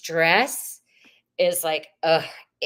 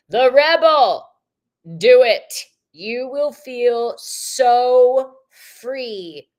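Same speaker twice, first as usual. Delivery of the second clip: louder, higher rallying call; excited card pull; 6 semitones higher, 8 dB louder, much slower than usual. Real, not acted. Reader urging Mary to walk away, loud and higher. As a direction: hype them up to break free. Pulls the rebel card with gusto.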